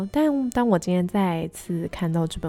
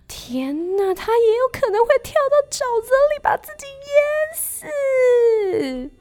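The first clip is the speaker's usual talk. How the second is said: much higher, panicky fretting heron; worried heron. Acted. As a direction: spiral into worry, near tears. Heron panics crane may drown.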